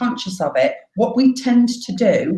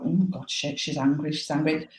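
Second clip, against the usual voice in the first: much softer, mock-alarmed murmur; onlookers' hushed reaction; 2 semitones lower, 9 dB quieter than usual. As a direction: imitate onlookers quietly passing on the news. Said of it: "people go".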